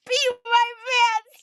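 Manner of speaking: high, breaking laughter through the words; barely getting words out between laughs; real helpless laughter, voice breaking; laughing hard. Real, not acted. Helpless laughter at daughter's joke.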